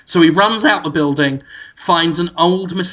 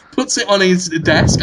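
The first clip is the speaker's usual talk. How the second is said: ordinary talk, nothing marked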